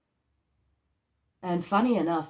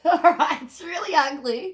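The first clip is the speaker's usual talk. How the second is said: real laughter through the words; laughing; 10 semitones higher, 6 dB louder than usual. Real, not acted. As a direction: laugh it out, amused and loud. Laughing at hearing "ugly.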